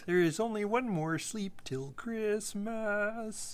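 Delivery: high, slow wobbly puppet voice; a drawn-out cartoon puppet. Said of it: "I used to be able to do Gonzo".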